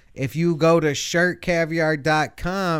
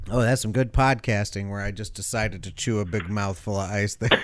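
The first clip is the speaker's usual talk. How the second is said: ordinary talk, nothing marked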